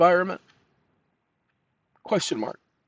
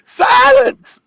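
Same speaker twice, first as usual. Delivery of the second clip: slow, then a much higher, far louder shout of Silence; 12 dB louder, much slower than usual. Real, not acted. Man silencing the talking assistant, full shout. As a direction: drown the machine out with a bellow.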